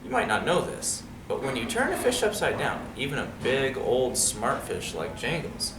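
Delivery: plain reading voice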